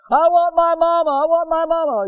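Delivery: put-on high, loud wailing little boy; boy crying for his mama; crying child. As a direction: wail like a hurt kid calling for his mother. Crying boy with broken leg.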